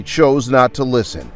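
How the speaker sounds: ordinary delivery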